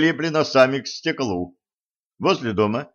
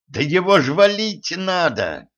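higher-pitched indignant urging; urgent exclamation; 6 semitones higher than usual. A narrator's reading. Princess urging Denis to attack, urgent exclamation. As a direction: push it out as an urgent demand. Princess urges killing the villain.

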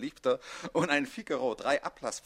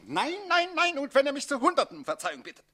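high, loud, rapid shouted nos; furious shouting refusal; 8 semitones higher, 4 dB louder than usual. Acted. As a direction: refuse every plea furiously. Count refuses pleas for pardon.

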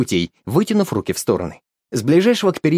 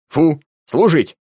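louder sharp orders; command; 4 semitones higher, 4 dB louder, much slower than usual. Acted. Grandpa commands the dog, firm. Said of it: "дал команду дед".

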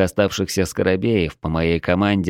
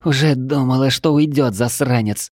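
higher-pitched croaky exclamation; hoarse rasp; 5 semitones higher than usual. A narrator's reading. Fighter to comrade who let the beast cry out, hoarse rasp. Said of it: "прохрипела она".

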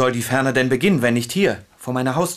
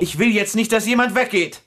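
high, urgent demand; heated plea that nobody leave; insistent refusal; 8 semitones higher than usual. Acted. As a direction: put your foot down, voice raised in pitch. Refusing to let others leave.

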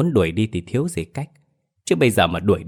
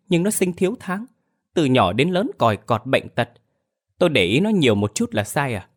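much higher, fast, defensive whine; flustered defensive wife; 6 semitones higher than usual. Acted. Wife defends her favouritism to husband, flustered and high. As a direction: protest shrilly, caught out.

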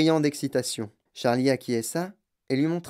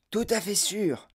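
passionate; 8 semitones higher, slower than usual. A narrator's reading. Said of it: "lança le vieil homme avec passion".

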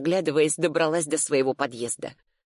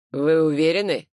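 louder lawyer's question with a steely edge; 5 dB louder than usual. A narrator's reading. Lawyer checks with his client about being watched, steely. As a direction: ask it firmly, a touch of steel. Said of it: "В голосе адвоката проступили стальные нотки".

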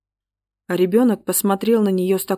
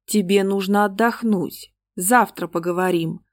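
plain reading voice

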